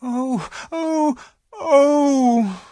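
high, loud anguished wailing cries; crying out. Acted. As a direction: wail in grief at the empty table. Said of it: "cried the two girls".

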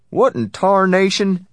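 high, loud, drawn-out outburst; exclaiming. A narrator's reading. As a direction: exclaim it slowly in disbelief. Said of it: "the tall man exclaimed".

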